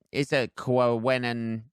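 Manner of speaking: ordinary talk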